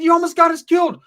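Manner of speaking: high, loud retold outrage; hammed-up angry shout; mock outraged yell.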